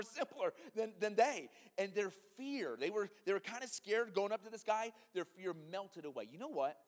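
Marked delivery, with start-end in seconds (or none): none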